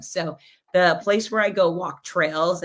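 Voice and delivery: usual speaking voice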